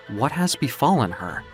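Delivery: ordinary talk, nothing marked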